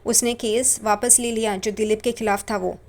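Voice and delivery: plain reading voice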